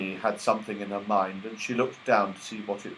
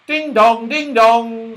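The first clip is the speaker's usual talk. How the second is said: clock chime; 11 semitones higher, 9 dB louder, slower than usual. Acted.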